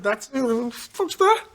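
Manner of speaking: high, drawn-out puzzled mock voice; slow and high, like a baffled passer-by; imitating the cop. Telling the host about the cop, a high, slow, baffled mock voice.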